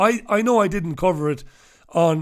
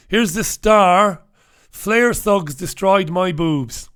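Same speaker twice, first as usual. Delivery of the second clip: usual speaking voice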